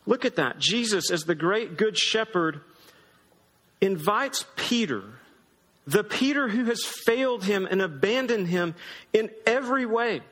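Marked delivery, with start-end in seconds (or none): none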